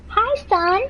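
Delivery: very high sing-out mom voice; mom's greeting. Acted. Mom spotting her son at the window, high mom voice. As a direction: greet him in a high-pitched put-on mother's voice.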